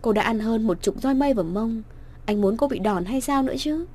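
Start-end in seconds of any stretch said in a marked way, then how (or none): none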